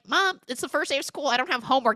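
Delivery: put-on high, quick teen objection; mock protesting son. Retold teenage son protesting.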